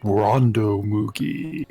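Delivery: booming, drawn-out host announcement, slowed down at the end; show announcer intro. Acted. Announcer welcoming viewers to the stream, big and slow. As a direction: roll out the host's name like a game show.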